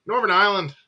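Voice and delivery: lightly Irish Northern Ireland; Irish accent. Shown as a demonstration. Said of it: "Don't know why I went Irish when I said Ireland".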